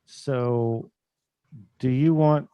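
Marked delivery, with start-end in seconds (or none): none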